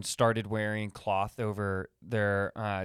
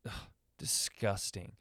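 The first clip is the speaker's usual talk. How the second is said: quiet, disdainful gossip; judgy; 3 semitones lower, 8 dB quieter than usual. Pretend. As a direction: sneer under your breath. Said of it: "cavemen and women were just judgy".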